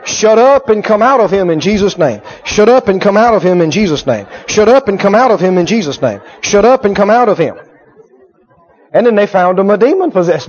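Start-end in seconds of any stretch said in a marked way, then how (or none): 0.0-7.6 rattled-off mock drill; same falling tune on each repeat, like memorizing; rote practice. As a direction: repeat it mechanically without pauses. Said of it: "So they practiced it".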